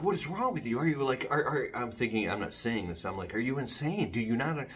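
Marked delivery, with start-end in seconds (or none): 0.0-1.7 high, loud, panicked outburst at her; retelling the panic, voice jumps up high and loud; frantic disbelief. Retold or imitated. Panicking husband asks his calm wife what is wrong, frantic and high. 3.3-4.7 frantic disbelief. Retold or imitated. Panicking husband asks his calm wife what is wrong, frantic and high.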